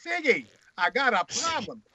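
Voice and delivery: lightly put-on Hebrew accent; mock Hebrew accent. Writer greeting his friend Freud with his problem, mock Hebrew accent. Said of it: "didn't say it with a Hebrew accent".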